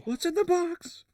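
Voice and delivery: high, hammed-up Se7en line; movie quote voice. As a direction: squeak out the famous line.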